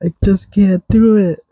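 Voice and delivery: mock despair over mashed potatoes; mini breakdown. Real, not acted. Podcaster wailing about mashed potatoes, mock despair. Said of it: "me having a little breakdown".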